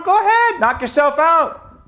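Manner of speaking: high, sarcastic dare. Real, not acted.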